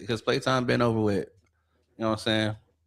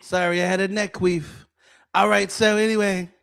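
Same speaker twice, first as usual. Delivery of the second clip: high put-on presidential voice; Trump; 8 semitones higher than usual. Excusing himself after a dramatic pause, Trump impression. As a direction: apologize in character as a comic politician. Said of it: "Says the Trump pussy man".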